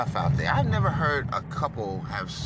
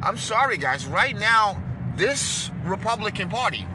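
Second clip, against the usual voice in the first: fired-up outburst; 6 semitones higher, 4 dB louder than usual. Real, not acted. Fired-up apology mid rant.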